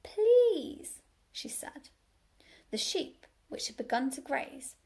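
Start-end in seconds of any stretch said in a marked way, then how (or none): 0.0-1.0 high, loud, effortful plea; strained courtesy, pitched up; forced politeness. A narrator's reading. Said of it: "She took a deep breath".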